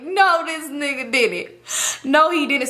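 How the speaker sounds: breathless laughing disbelief. Real, not acted. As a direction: laugh it off in shock.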